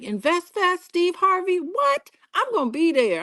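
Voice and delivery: lightly voiced eager fan; mock excited fan. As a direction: act out a thrilled fan, rising on What. Fans quoted reacting to Invest Fest.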